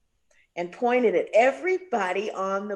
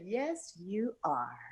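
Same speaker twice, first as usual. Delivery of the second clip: much softer, gentle "yes, you are"; soft reply; 9 dB quieter, much slower than usual. Retold or imitated. [name] gently welcoming the new girl singer, soft. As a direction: drop to a soft, kindly murmur.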